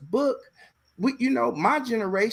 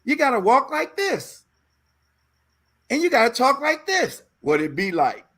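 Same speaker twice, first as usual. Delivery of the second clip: high, pushy acting coach voice; lightly voiced teacher coaching jive; 6 semitones higher than usual. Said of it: "And he's like".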